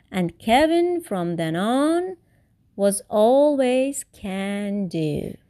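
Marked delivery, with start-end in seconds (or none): none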